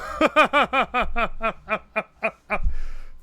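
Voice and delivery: long staccato laugh, tapering off. Real, not acted. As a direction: laugh it out in a long run of short bursts. Amused after finishing the round.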